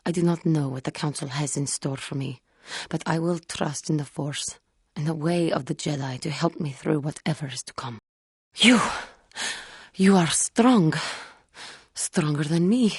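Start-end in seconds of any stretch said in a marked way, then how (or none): none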